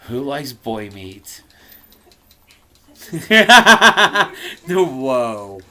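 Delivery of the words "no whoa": loud burst of laughing; real laughter. Real, not acted. Reader breaks up laughing at boy meat. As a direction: crack up at the line.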